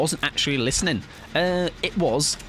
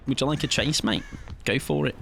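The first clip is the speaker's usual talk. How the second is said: usual speaking voice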